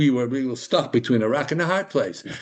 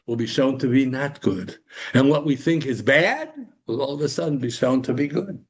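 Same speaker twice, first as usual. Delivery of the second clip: ordinary talk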